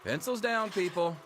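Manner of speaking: loud call to the class. Acted. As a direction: call it out across the room. Teacher ends exam, calling class.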